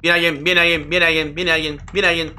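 hurry, someone's coming; urgent alarm. Real, not acted. Player warning the audience that someone is coming, urgent.

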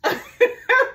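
high loud laugh; bright burst of real laughter. Real, not acted. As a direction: crack up laughing. Reacts to story with laugh.

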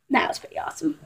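whisper. Real, not acted. Whispered brag about winning.

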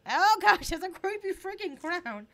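loud, high creeped-out exclamation; startled. Real, not acted. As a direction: recoil loudly from a creepy sight.

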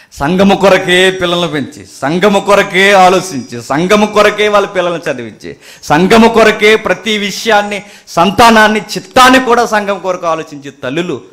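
loud, high, insistent litany; full-force preaching. Acted. Preacher praising mothers who live for the church, full-force preaching. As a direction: build a list with ringing repeated openings.

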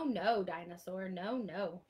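ordinary talk

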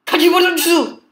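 loud, high-pitched burst; shouted command. Real, not acted. Player shouting odd words at the game, high.

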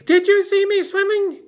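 very high, loud little squeal; excited squeak. A narrator's reading. Roo wants Kanga to admire the swim, squeaking excitedly. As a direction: squeak it out, bursting to be noticed. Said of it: "squeaked Roo excitedly".

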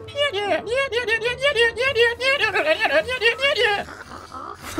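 long, high wheezing laugh; Marge Simpson-like laugh. Real, not acted. Streamer cracking up over snakes everywhere, high Marge-like laugh. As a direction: laugh helplessly in one high, rattling stream. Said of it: "Sounds like Marge Simpson".

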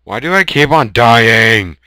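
loud, high scream; frustrated shout. Real, not acted. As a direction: yell it in frustration. Dies again.

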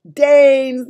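very loud, high giant shout; triumphant cry. A narrator's reading. Giant answering Sophie's puzzle, triumphant shout. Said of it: "the giant cried triumphantly".

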